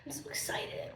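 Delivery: breathy excited whisper. Real, not acted. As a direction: whisper it with glee. Whispered excitement over food.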